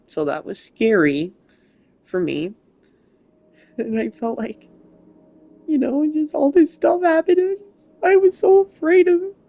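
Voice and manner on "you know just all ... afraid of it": fighting back tears while admitting fear; getting emotional. Real, not acted. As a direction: voice rising high with emotion, near tears. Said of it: "I'm sorry I'm getting emotional".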